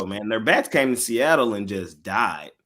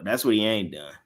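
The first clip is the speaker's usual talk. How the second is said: usual speaking voice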